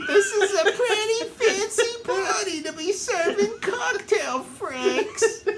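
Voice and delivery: very high haughty mock lady; snooty falsetto. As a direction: sniff it out like a snooty hostess.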